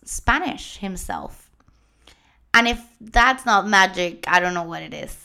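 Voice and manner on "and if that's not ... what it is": ordinary talk, nothing marked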